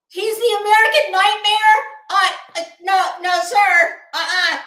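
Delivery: no way, sir, not him, loudly; indignant no. Real, not acted. Indignant denial of Cody's nickname.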